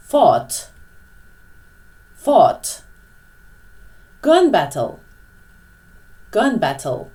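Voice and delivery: each word said slowly and clearly, with a pause after; slow model words. Shown as a demonstration. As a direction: model the words for students to repeat. Teacher models each word for repetition.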